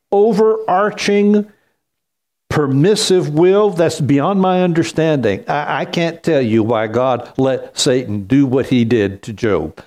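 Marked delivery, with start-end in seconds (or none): none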